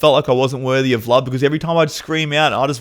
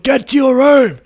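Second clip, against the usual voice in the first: put-on sharp high angry shout at a child; dad yelling; 13 semitones higher, 7 dB louder than usual. Father yelling at his seven-year-old son, angry shout. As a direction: bark the order like an enraged father. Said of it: "And he's like".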